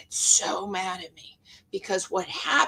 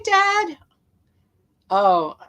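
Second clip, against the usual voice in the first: usual speaking voice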